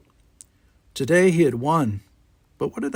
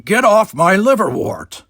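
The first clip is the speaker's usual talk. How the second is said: loud, high, slow angry bark at an intruder; 9 semitones higher, 8 dB louder, slower than usual. Acted. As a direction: bark it like a furious old gardener. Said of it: "a voice barked at him".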